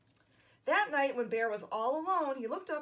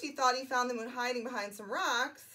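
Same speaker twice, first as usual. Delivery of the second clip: ordinary delivery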